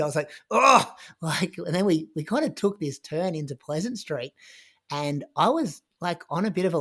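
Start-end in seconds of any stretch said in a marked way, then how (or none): 0.5-0.9 loud, high moaning cry; pained yell. Retold or imitated. Runner hit from behind in a pile-up, moaning yell. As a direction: yell out an "oh" of sudden impact. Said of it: "I think I yelled out, like, moaned".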